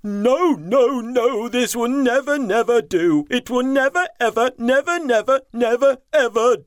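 chief elephant protesting. Acted. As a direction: refuse it firmly in a high voice. Chief elephant rejects muddy water.

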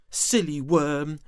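high, loud scolding worm. Acted. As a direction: scold him like a big sister. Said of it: "she scolded".

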